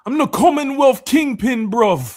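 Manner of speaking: high, loud British wrestler boast; Will Ospreay impression. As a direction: crow it like a brash British champion. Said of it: "like, a Will Ospreay line".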